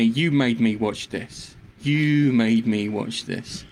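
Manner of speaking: low, very slow, deliberate blame; mock accusation. Real, not acted. Co-host blaming friend for the show, slow accusation. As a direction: draw out each word accusingly, pitched low.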